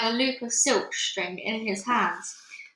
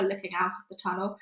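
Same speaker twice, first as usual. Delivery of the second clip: ordinary delivery, nothing marked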